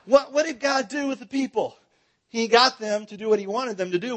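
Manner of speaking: ordinary talk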